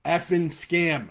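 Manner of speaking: heated, emphatic plea; passionate rant. Real, not acted. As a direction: drive the warning home hard. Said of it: "one thing I'm passionate about".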